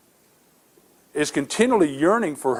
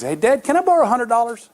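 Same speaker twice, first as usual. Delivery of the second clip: put-on higher, lightly childlike kid voice; imitating a young son; 9 semitones higher, 3 dB louder than usual. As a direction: play the eager little boy asking his father. Son asks dad for money.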